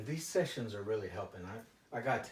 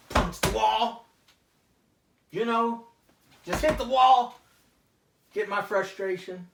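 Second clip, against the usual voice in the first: shouting; 11 semitones higher, 14 dB louder than usual. Acted. Describes punching wall.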